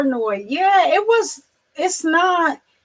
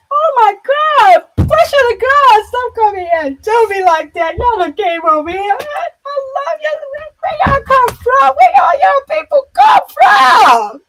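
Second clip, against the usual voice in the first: much higher and louder, shrieking and sobbing with joy; overjoyed crying shout; 14 semitones higher, 7 dB louder than usual. Real, not acted. Visitors arrive during the stream.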